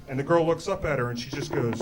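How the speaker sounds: usual speaking voice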